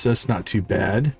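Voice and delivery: normal talking voice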